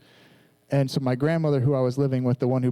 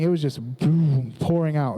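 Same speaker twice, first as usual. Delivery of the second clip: ordinary talk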